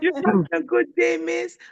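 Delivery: lightly voiced child; little boy asking. Teacher imitating the boy on the phone. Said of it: "he said".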